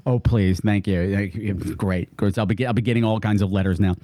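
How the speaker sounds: low, understated Irish lilt; mock Irish accent. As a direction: grumble politely in a put-on Irish voice. Mock Irish reply about accents.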